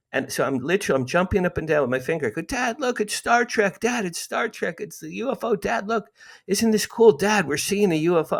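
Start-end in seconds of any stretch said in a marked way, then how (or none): 2.4-8.4 put-on much higher, breathless excitement of a boy; thrilled kid. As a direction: point and squeal at the UFO for dad. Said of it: "I was so thrilled".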